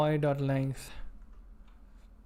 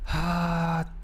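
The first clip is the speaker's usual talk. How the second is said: loose Southern cowboy twang; cowboy accent; 3 semitones higher, 4 dB louder than usual. Shown as a demonstration. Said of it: "Can you do the South American accent?".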